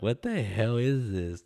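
retold bewilderment, stretching the words; confused. Man recalls getting hit with dough, confused. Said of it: "so confused the first time".

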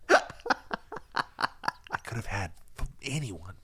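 slow whisper, laughing at the end; whispered Macy impression. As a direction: drag it out in a breathy mock lament. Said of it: "I'm making him whisper".

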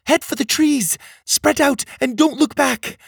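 high-pitched calling to a crowd; urgent, high warning call; urgent shouted orders. A narrator's reading. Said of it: "Cogleen shouted to them".

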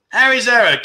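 usual speaking voice

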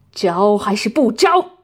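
loud menacing question; threatening demand. Acted. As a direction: bark the demand at her. Torturer repeats his demand.

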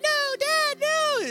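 high whiny pleading; mock crying little brother. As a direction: beg dad like a bawling little kid. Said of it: "is crying".

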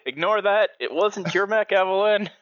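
high, loud in-character call. Acted. Mechanic calls to Evelyn after something drops, high loud call. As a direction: call it up to the cockpit, pitched high.

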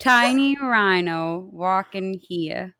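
slow, lightly done New York accent. Shown as a demonstration. Friend asked to try the line, New York accent. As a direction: drag it out like a New York cabbie. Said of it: "[name], give it a shot".

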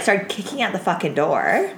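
usual speaking voice

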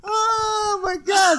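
high laughing yell; laughing shriek. Real, not acted. Laughing scream.

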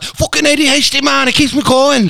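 high, loud hammed-up Scouser voice; mock Scouse accent. Retelling the rapper's words in a hammed-up Scouse accent. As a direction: ham up a Liverpool accent, pitched high. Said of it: "went from being a Cockney to a Scouser".

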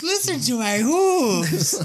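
high, loud, slow, hammed-up horse; silly horse showing off its hooves; mock horse voice. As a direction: play the proud horse, drawn out. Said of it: "sound of my hooves".

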